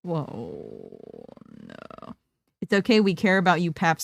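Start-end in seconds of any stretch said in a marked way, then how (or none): 0.0-2.2 very low, dropped voice; deep voice. Acted. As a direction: drop way down low. Very low far voice.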